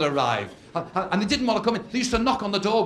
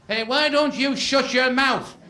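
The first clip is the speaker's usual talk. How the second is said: heckling; 5 semitones higher, slower than usual. Acted. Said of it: "the bride's side going".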